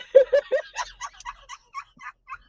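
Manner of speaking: real high-pitched burst of laughter; laughing. Real, not acted. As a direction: break into long, squealing laughs. Helpless laughter at her own story.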